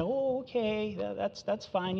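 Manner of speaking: high, soft indulgent shrug; mock a doting grandpa waving it off; a hint of a doting grandpa; lenient grandpa. Mocking a lenient grandpa God.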